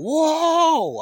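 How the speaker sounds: high, loud Whoa!; sudden alarmed shout; startled holler. Retold or imitated. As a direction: yell it as the dog charges. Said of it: "I probably even hollered".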